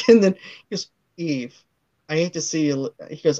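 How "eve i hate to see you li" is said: low, soft line to a wife; mock sweet talk. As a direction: drop the voice and murmur it to her. Acted come-on to wife.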